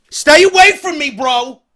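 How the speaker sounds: loud high alarmed warning; loud panicky stay away; backing away. Retold or imitated. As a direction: yell at him to keep off. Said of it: "Backing away".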